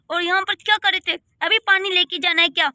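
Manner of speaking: ordinary talk, nothing marked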